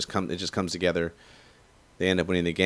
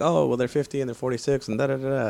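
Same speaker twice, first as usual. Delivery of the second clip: mocking others; 5 semitones higher than usual. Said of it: "and everyone's like".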